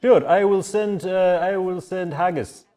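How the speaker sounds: high, loud host voice; innkeeper character. Acted. Innkeeper agreeing to send word to the inspector, in character. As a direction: play an obliging innkeeper, pitched well up.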